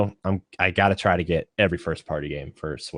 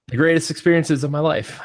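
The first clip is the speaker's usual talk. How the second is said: excited; 9 semitones higher, 5 dB louder than usual. Real, not acted. Gushing about childhood games.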